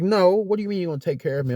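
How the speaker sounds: retold indignant protest. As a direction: push back at being handled. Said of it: "I'm like".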